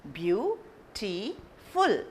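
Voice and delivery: Beautiful said slowly, syllable by syllable; Beautiful stretched out so each syllable is heard; syllables spelled out. Shown as a demonstration. Teacher sounding out a word for students, slow and syllable by syllable. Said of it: "Beautiful is a three syllable word".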